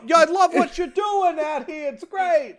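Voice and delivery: high, hammed-up Brooklyn voice; butter him up in a high Brooklyn accent; New York Brooklyn accent. Gotham crook flattering Batman, high Brooklyn accent. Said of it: "everyone in Gotham has that New York, Brooklyn accent".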